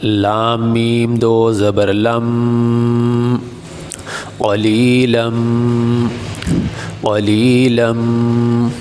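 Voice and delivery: قلیلم with the tanween merged into the meem and held with ghunna; nasal ghunna merge. Shown as a demonstration. Teacher shows merging tanween into meem, slow and drawn out. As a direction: hum the ending of قلیلم slowly through the nose. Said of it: "میم کے ساتھ ملا دیں گے".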